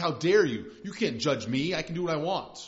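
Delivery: indignant outburst, loud at the start; mock the offended sinner pushing back; angry. People confronted with sin snapping back, mildly acted anger. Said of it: "they get angry".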